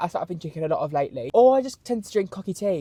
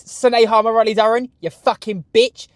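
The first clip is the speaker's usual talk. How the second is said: usual speaking voice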